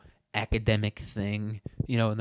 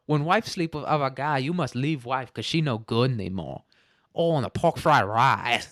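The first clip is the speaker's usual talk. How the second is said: mock Chinese accent; 3 semitones higher than usual. Retelling the movie's fortune cookie joke, mock Chinese accent. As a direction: imitate a caricatured Chinese accent. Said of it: "funny to do a Chinese accent".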